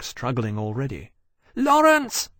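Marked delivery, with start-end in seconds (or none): 1.5-2.3 high, loud anxious call; crying out. A narrator's reading. Dragon searching for his captain among dim figures, crying out. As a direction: call the name out across the distance. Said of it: "Temeraire cried".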